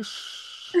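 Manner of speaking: soft unvoiced "shh"; imitated shushing. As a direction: mimic being hushed by the kids. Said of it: "Why suddenly you going".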